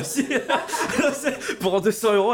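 laughing through the words; giggling while talking. Real, not acted. Laughing at his own joke.

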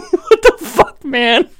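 voicing the dog's confused reaction through laughter; squeaky laughing "what the fuck, man"; giggling mock dog voice, up high; dog's baffled look. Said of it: "the look on the dog's face is just like".

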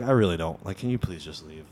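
low, soft, weary plea; subdued, nerdy bar manager. Retold or imitated. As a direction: plead quietly like a worn-down manager. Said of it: "kinda, like, nerdy".

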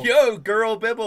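loud, high gleeful call; excited shout. Real, not acted. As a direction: cheer it out with delight. Excited at pink Bibble.

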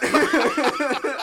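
loud laugh. Real, not acted. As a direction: burst of high laughter. Laughing at the dream.

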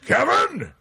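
high, sharp mock call down the line; acted parental outburst; angry dad. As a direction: imitate a father demanding his son. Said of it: "it's Dad".

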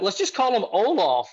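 loud, high call across the group; yelled out. Retold or imitated. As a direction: shout the nickname out, half mocking. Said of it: "yelled out".

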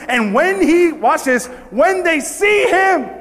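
high, emphatic shout on the climax; preacher's climactic cry; fired-up preaching. Acted. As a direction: build to a slow, drawn-out peak. Preaching builds to a peak.

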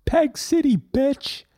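high, put-on rapper shout-out; mock Winnipeg rapper. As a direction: brag like a hometown rapper. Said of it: "Twenty-five rappers coming out of Winnipeg".

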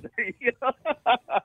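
bursting out laughing at the joke; a high, real laugh; sudden high laugh. Real, not acted. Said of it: "I'm laughing too".

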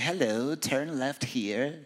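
slow, badly faked British GPS voice; terrible British accent. GPS giving directions, terrible British accent. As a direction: put on a poor British accent. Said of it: "guy with a British accent".

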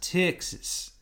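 drawn Texan twang on Texas; Texas accent. Shown as a demonstration. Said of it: "wanna do a Texas accent".

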